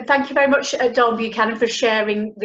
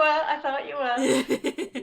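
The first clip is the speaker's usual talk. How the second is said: laughing; 4 semitones higher, 4 dB quieter, much slower than usual. Real, not acted. Host joking with guest.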